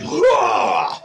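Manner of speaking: high, loud war cry; battle cry. Acted. Giant woman jumps at the dragon, loud and high. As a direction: leap and roar it out. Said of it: "you just launch off".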